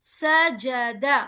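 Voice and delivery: "सजदा" slow, three equally short vowels; correct even syllables. Shown as a demonstration. Teacher correcting to the right "सजदा", slow and even. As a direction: keep "सजदा" measured to the last syllable. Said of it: "नहीं".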